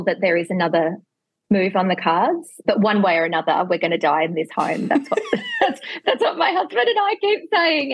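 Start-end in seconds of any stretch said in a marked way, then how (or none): 4.9-7.9 high-pitched, laughing through the words; laughing while talking. Real, not acted. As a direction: crack up at your own joke while speaking. Laughs at own joke about dying there.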